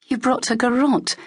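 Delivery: disbelieving rise on garrote; surprised. A narrator's reading. Locke spotting Cale's hidden garrote, surprised and disbelieving. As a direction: catch her off guard with it.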